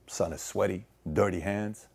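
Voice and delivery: ordinary talk, nothing marked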